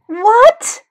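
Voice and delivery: mother hearing of the suspension, yelling. A narrator's reading. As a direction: yell it in disbelief. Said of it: "yelled Mom".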